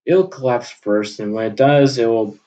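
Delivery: ordinary delivery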